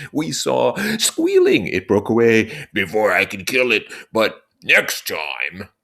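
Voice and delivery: put-on high, slow, eager boy's voice; Jack boasting. Jack telling the assembly about the pig, high and eager. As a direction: relive the pig that got away.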